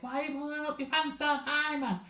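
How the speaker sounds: high-pitched nagging grandma impression; scolding grandma. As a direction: imitate a grandma telling a child off, high. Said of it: "my grandma telling us".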